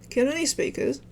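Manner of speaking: ordinary talk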